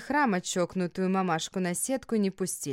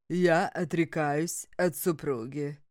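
slow, lower solemn vow; solemn renunciation; 3 semitones lower, slower than usual. A narrator's reading. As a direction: declare it gravely as the husband. Husband's ritual renunciation.

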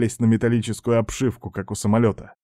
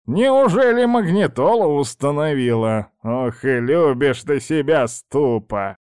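put-on louder, teasing old witch; 4 semitones higher, 3 dB louder than usual. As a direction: tease the vain mortar. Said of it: "сказала Баба Яга".

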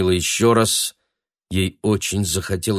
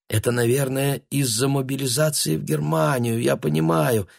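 put-on softer, puzzled elderly lady; a hint of a frail old woman; quietly bewildered old woman; 3 semitones higher, 4 dB quieter than usual.

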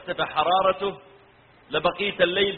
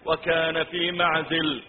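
slow melodic tilawa; Quran recitation; 2 semitones lower than usual. Acted. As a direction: recite slowly, drawing out the long vowels. (تلاوة قرآنية مرتلة)

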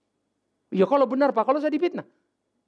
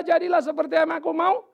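higher, insistent, rising on mau; the wrong servant's prayer, pushy and higher; self-willed demand; 8 semitones higher than usual. Retold or imitated. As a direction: demand your own way. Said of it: "waktu dia berkata".

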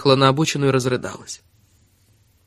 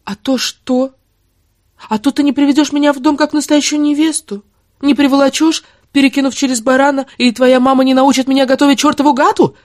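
high-pitched, angry challenge, building louder; defiant mockery; 11 semitones higher, 5 dB louder than usual. Woman defying her lover's warning, high and scornful. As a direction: scoff at the threat, building to a loud finish. She defies his warning.

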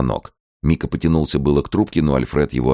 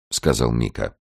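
ordinary delivery, nothing marked

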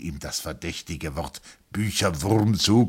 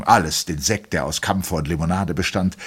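plain reading voice

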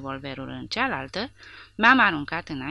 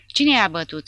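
shouted question; 3 semitones higher, 9 dB louder than usual. A narrator's reading. Porta interrogates the prisoner.

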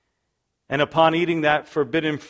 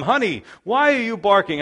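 mock pleading with dog; 6 semitones higher, 5 dB louder than usual. Talking to his dog.

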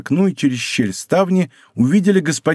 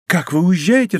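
mild startled exclamation, a little higher; startled cry; 4 semitones higher than usual. A narrator's reading. Bonacieux hears he is leaving, mild startled cry. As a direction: cry out in restrained surprise. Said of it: "вскричала госпожа Бонасье".

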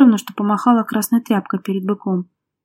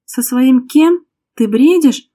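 high, loud, drawn-out incredulous cry; desperate raised voice, stretched words; desperate disbelief; 4 semitones higher, 7 dB louder, much slower than usual. A narrator's reading. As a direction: exclaim as if they've gone mad. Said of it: "отчаянно воскликнула Лера".

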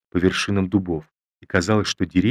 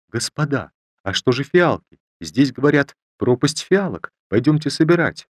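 excited; 5 semitones higher, 4 dB louder than usual. A narrator's reading. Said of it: "вдруг спохватилась".

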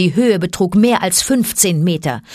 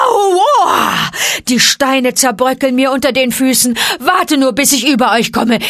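high, loud puffing cry; gasping bear shout; 7 semitones higher, 5 dB louder than usual. A narrator's reading. Baloo shouts encouragement, loud and high. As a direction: bellow while climbing, out of breath.

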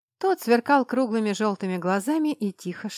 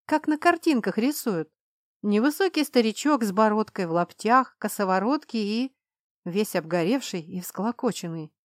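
ordinary delivery

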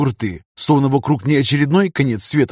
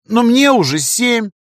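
put-on high, slow bratty child voice; stubborn little girl; 10 semitones higher, 3 dB louder, slower than usual. A narrator's reading. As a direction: insist like a stubborn kid. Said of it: "продолжала она вредничать".

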